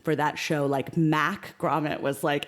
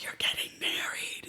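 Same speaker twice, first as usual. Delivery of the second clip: choked-up whisper, much softer; tearful whisper; 10 dB quieter than usual. Retold or imitated. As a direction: whisper it through tears of surprise. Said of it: "he starts tearing up".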